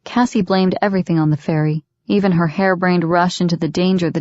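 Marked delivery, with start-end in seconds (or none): none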